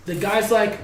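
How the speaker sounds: ordinary delivery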